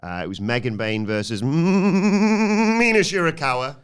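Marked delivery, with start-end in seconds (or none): 1.4-3.8 long rolled-out name, climbing high and loud; soaring announcer-style introduction; mock ring announcer. Mimics announcer drawing out wrestler's name.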